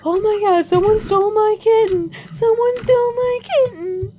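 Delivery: very high, loud hysterical wail; mock hysterical cat owner screaming; frantic woman shrieking. Cracked-out woman's frantic outburst.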